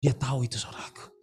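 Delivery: unvoiced, hushed aside; whisper. Real, not acted. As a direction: whisper it like a secret. Preacher whispers the point. (bisikan pelan)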